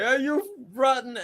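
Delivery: high, slow cursing pirate voice; pirate swearing. Retold or imitated. Skull pirate cursing the mermaids as the ship passes, high and slow. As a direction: curse them out in a high, drawn-out pirate voice.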